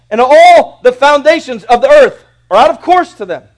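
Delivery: loud, high, ringing sermon voice; thundering pulpit delivery; full-force preaching. Acted. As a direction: proclaim it to the whole church.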